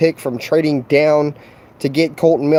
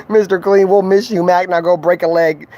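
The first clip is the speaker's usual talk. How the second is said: mock farewell; 5 semitones higher, 3 dB louder than usual. Mocking farewell to traded player.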